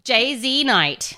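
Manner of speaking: slow, spelled-out "J Z Knight"; deliberate, drawn-out clarification; careful correction. Real, not acted. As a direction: space out each part to clear up the mishearing. Correcting a misheard name.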